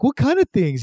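lightly voiced elves asking Santa; curious elves. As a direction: chirp it like nosy elves.

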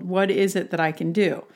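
ordinary talk

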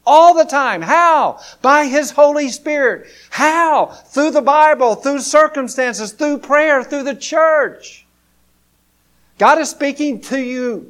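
loud, high, driving sermon delivery; full-force preaching. Real, not acted. Preacher urges the congregation hard, loud and high. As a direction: preach it out with rising fire.

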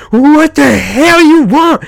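put-on high, loud, drawn-out old-man holler; slow, strained, hammed-up cantankerous shout; cranky old man. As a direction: yell it like an irritated old motel clerk. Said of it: "this old man comes out".

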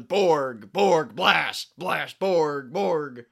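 loud, punched one-word battle cries; mock heroic yelling. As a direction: yell each word like an attack move. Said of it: "doing poses and yelling".

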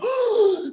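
sharp, high shocked gasp; loud gasp. Retold or imitated. Mom hears house flooded, high shocked gasp. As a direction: suck in air in horror. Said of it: "She gasped".

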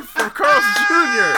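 high, slow incredulous shout. Real, not acted. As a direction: shout it in disbelief. Incredulous about Yelp reviews.

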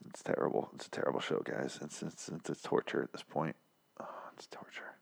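despairing whisper, barely voiced. Real, not acted. Podcast host to listeners, whispering in despair. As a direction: breathe it out, defeated. Weary host mutters about the show.

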